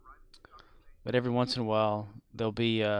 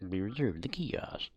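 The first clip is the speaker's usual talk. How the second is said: whisper; 8 dB quieter than usual. Real, not acted. Reading under breath.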